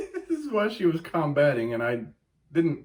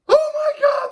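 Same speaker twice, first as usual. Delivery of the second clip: much higher and louder excited squeal; a shopper overjoyed at a bargain; mock ecstatic shout; 7 dB louder than usual. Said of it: "I was like".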